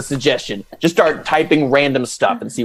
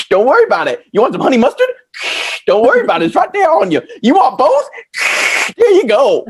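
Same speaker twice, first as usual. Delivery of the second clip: high, goofy clown voice; lightly played silly clown; 5 semitones higher than usual. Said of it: "your clown voice".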